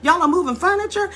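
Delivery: high, quick surprised question; Jim's surprise. Retold or imitated. Jim Brown surprised they're moving furniture, high. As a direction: Jim surprised, offering to help. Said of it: "Jim says".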